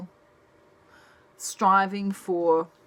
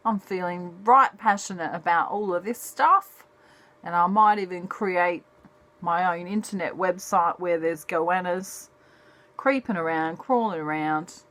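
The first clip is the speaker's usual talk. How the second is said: put-on broad Australian accent. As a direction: play a broad, matey Australian character. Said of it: "my accent sounds very Australian".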